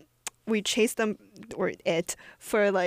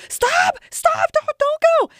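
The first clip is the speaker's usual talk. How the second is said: high, loud reenacted shout; imitated yelling; 9 dB louder, slower than usual. Retelling bystanders yelling at cars, high shouted cries. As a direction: yell it out like alarmed passers-by. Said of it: "like, people yelling at cars".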